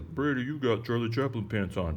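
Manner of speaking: mock wife voice, lightly done; imitating wife. Husband quoting his wife's teasing remark, light mock voice. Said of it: "my wife says".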